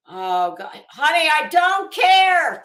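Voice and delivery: weary husband. Retold or imitated. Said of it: "and he's like".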